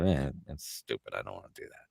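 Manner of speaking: low, soft, dismissive grumble; grumpy dismissal. Retold or imitated. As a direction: re-enact a reluctant player brushing it off. Recalls dismissing some improv games.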